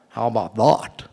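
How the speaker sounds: loving impression of a catchphrase; imitating her odd pronunciation. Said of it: "the way that she pronounced it".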